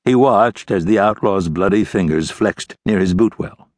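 usual speaking voice

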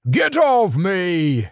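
a high, slow, panicked yell. A narrator's reading. Pinned Doublehead yells at Mac, panicked shout. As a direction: shout to throw the beast off you. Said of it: "Doublehead yelled".

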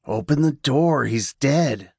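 sinister whisper in his head; evil whisper. Acted. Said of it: "whispered the evil, menacing voice".

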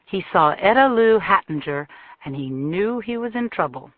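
ordinary delivery, nothing marked

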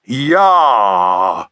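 a loud open yah noise. Acted. Father making a noise for Taffy: loud yah. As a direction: belt out the yah. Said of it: "very loud".

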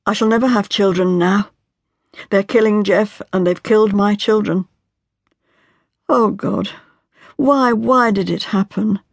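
a wife breaking down as she pleads; anguished young wife. Acted. Marian's grief, breaking down.

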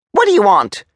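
high, loud demand; angry challenge. Acted. Major blocking the soldier's view, high and angry. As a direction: snap it loudly to block him.

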